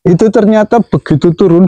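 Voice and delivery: usual speaking voice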